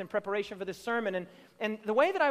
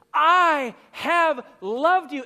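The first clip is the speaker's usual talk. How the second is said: louder, very slow and weighty; emphatic declaration; 4 semitones higher, 8 dB louder, much slower than usual. Retold or imitated. Preacher voicing God's opening words, slow and emphatic. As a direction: lean on each word. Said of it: "in the most emphatic language".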